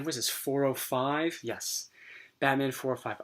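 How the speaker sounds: ordinary delivery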